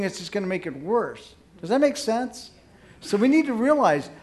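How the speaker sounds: ordinary talk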